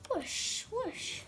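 whale whoosh. A narrator's reading. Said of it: "said the gigantic whale".

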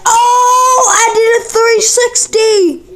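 thrilled shout, high and drawn out; excited cry. Real, not acted. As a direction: yell it in disbelief. Flip lands, cries out.

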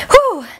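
a sharp, high, loud exhale between reps; exertion whew. Real, not acted. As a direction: blow the effort out hard. Mid-workout exertion exhale.

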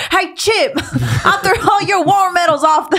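put-on high kid voice through laughter; high, laughing taunt; mock kid taunt. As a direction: imitate a movie brat, cracking up. Quoting kid taunting grandpa, laughing.

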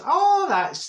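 high, loud gushing "Oh, that's"; put-on gush of politeness. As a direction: overdo the delight, squealing up high. Fake delight to hairdresser.

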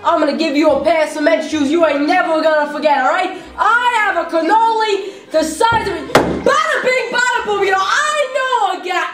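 loud, fast mafia tough guy; mobster voice. Acted. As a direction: threaten like a wiseguy. Plays a mobster threatening.

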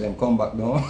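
low, soft mock nudge from the wife; a hint of the wife gently pulling him back; calm wife's nudge. Wife catches him staring into space, low and soft. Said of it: "She said".

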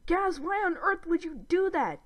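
high-pitched indignant demand; exasperated growl. A narrator's reading. Brother confronting his sister over the prank, exasperated. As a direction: scold with mild anger, no real growl. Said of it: "Dib growled at her".

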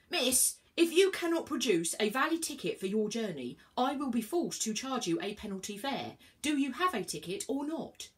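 fed up and lecturing; huffing. A narrator's reading. Inspector huffs about a penalty fare. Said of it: "he huffed".

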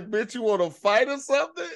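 hammed-up picking a fight; mock tough challenge. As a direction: call them out like a street tough.

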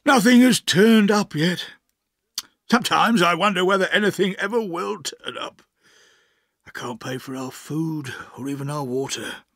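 self-pitying gentleman's moan; miserable. Acted. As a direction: pour out your despair to an old friend. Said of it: "he said miserably".

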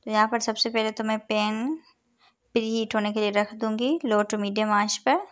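normal talking voice

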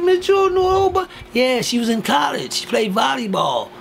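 mock the fancy mourners; high snooty mourner voice making small talk; uppity guest. Mocking uppity funeral guests.

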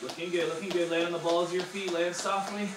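upbeat workout coach cues; aerobics instructor. Acted. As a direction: coach the class through drills. Fitness instructor cueing a workout.

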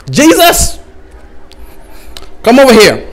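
God calling Jesus. Acted. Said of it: "God decides".

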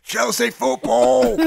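slow, high parody of bad Australian; mock Australian accent. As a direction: bellow a botched Australian accent. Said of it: "Here's [name]'s Australian impersonation".

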